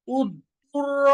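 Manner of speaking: উত্তুর র drawn out with a rolled র; slow correct recitation. Shown as a demonstration. Teacher modeling the drill slowly for practice. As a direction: say উত্তুর র slowly, letters kept apart. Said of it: "এরকম".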